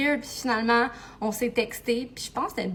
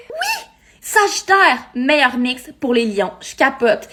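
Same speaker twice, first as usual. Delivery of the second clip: thrilled shout; 8 dB louder, slower than usual. Acted. Thrilled friend in skit.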